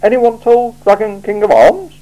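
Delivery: wide-eyed feigned innocence; innocently. Acted. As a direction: ask as if you know nothing. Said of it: "said Vimes innocently".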